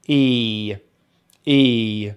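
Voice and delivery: "E" held as a long ee; long vowel demo. Shown as a demonstration. Teacher showing the long vowel to learners, "E" drawn out. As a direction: stretch "E" with spread lips. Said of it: "which we make by spreading the lips".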